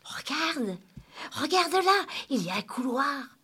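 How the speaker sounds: put-on high, eager child's exclamation; eager and high-pitched; excited. A narrator's reading. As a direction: urge them on with rising excitement. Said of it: "s'emballa Émilie".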